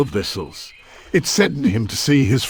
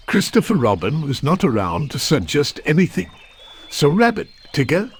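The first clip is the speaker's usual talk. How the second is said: usual speaking voice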